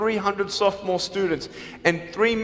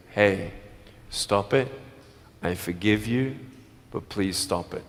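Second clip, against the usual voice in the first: calm plea; 11 semitones lower than usual. Retold or imitated. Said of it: "go up to the people who still tease you and say".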